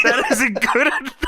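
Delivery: high, laughing through the line; laughing mock quote. Tracy dismissing the roster, said high through laughter. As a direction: quote "That isn't good enough" with a giggle breaking in. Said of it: "Tracy says".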